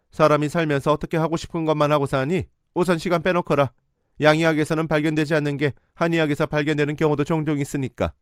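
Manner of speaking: a lightly played scolding mother; condescending mother. Mother dismissing her refusal.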